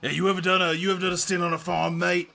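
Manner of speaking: a mock tough Brit with a hint of a growl; hammed-up British tough guy; bad British accent. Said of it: "a really bad Jason Statham impression".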